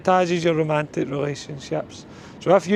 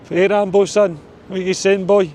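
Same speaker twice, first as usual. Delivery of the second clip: louder, higher call to his dog; 5 semitones higher, 5 dB louder than usual. Real, not acted. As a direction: hail the dog cheerfully.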